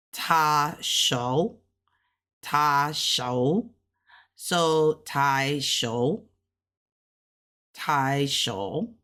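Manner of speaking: "ta shou" low, rising at the end; tone practice. Shown as a demonstration. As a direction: repeat "tai shou" slowly with a lifting end. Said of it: "that's the first tone".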